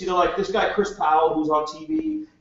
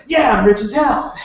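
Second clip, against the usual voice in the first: higher, louder cocky brag; mock boast; 6 semitones higher, 6 dB louder than usual. The speaker mocks someone after one meeting, with a cocky brag. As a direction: sell the fake bravado. Mocking a naive new recruit.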